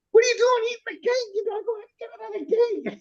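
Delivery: high, hammed-up scolding mother; mock mother scolding her brother over a cake; angry Italian mother. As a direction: shriek it like an overdone angry mom. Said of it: "goes overboard on the, the angry Italian mother".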